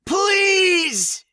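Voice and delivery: desperate high howl for help. Acted. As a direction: scream out for help at full voice. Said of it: "he howled".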